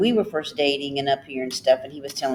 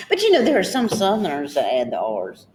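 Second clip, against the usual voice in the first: normal talking voice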